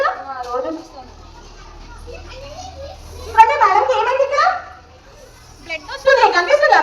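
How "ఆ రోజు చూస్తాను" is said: low, weak voice of an injured woman; mock-suffering teacher moaning about her eyes; acted pained complaint. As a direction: play the hurt victim, lower and drained. Madam acts hurt by phone use.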